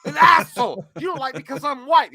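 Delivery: high, loud heated comeback; heated yell. Retold or imitated. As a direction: shout back, offended. Bruce Willis yelling back.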